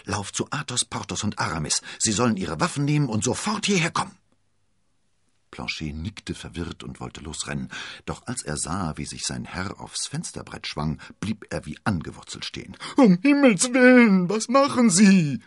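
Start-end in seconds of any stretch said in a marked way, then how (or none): 0.1-4.2 raised, pressing command that quickens; urgent, building to a loud, rapid finish; urgent order. A narrator's reading. Urgent order to his servant. 12.9-15.4 very high, loud, drawn-out wail; panicked high-pitched shriek; horrified outcry. A narrator's reading. Servant aghast as master climbs to jump.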